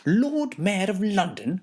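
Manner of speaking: high disbelieving question rising at the end; the cat can't believe its ears; incredulous cat. A narrator's reading. Cat doubting the bells' message, high incredulous rise. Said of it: "cries the cat".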